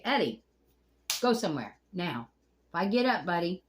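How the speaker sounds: loud stern call at the turtle; scolding a pet. Real, not acted. Scolding Eddie the turtle, loud and stern. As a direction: shoo him off, firmly and loud.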